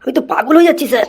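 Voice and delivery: high-pitched comic despair; exasperated wail. Acted. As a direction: complain high, at your wits' end. Exasperated teacher complains of going mad.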